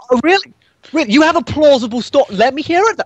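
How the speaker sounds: high, sarcastic disbelief; mock incredulous. GM voices sceptical NPC.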